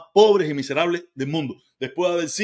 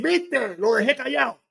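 higher, smug imitation of the critic; mock the critic's boast, higher and smug; mocking gloat; 5 semitones higher, slower than usual. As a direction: mimic his gloating, pitched up. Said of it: "y después tú decir".

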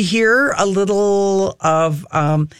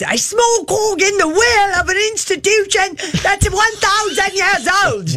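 a much higher, hammed-up British accent; bad British accent; 12 semitones higher than usual. Imitating the CNN royal pundit in a bad British accent. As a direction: send up the pundit's British accent with a mock-posh lilt. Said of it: "Worst accent ever".

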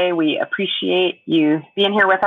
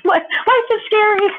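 laughing. Real, not acted. Laughing at her own worry.

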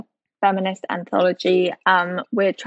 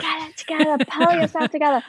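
high mock scolding; screaming dog voice; 8 semitones higher than usual. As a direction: pretend to be a yapping, bossy dog. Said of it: "yeah, she's screaming".

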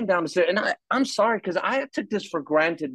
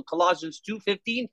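normal talking voice

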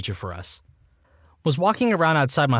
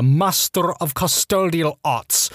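slow, higher, mock-reverent title; mock grandiose; 4 semitones higher, much slower than usual. Narrator giving the janitor a lofty title, mock grandiose. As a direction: draw it out with exaggerated pomp.

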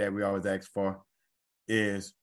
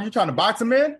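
much higher and louder, thrilled interjection; excited exclaim; 13 semitones higher, 8 dB louder than usual. Real, not acted. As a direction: pile on with real excitement. Co-host jumps in excitedly.

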